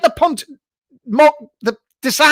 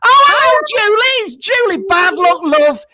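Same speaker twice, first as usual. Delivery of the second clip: psychic greeting; 10 semitones higher, 4 dB louder than usual. Acted. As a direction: sing out the name like a stage medium. Psychic greets caller on screen.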